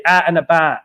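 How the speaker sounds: "Out and about" in loud Cockney, "out" said as "at"; Cockney accent on "Out and about". Shown as a demonstration. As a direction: show off the Cockney "Out and about", flattened to "at an' abaht". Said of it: "Cockney accent out for out and about".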